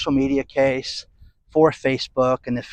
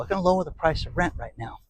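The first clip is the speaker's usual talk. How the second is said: ordinary delivery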